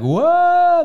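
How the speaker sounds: a big wowed gasp of a word; mock-awed exclamation; amazed wow. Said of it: "Sie gewähnen, like".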